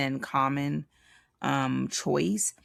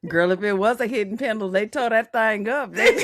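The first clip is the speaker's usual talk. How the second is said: playful shout; 6 semitones higher, 6 dB louder than usual. Real, not acted. Joking about busted armoire.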